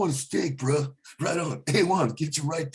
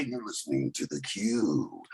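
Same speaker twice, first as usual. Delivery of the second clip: slow, low, soft DJ intro; radio announcer voice; 8 semitones lower, 6 dB quieter, slower than usual. Acted. Comic demoing a station intro, slow low DJ voice. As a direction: purr the station ID like a late-night DJ. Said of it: "a radio intro".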